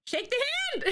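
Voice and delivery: high excited cry to a kindred spirit. Real, not acted. Excited about shared bagel pronunciation.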